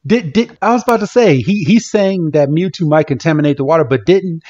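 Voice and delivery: real incredulous rant, pitch shooting up; indignant disbelief. Real, not acted.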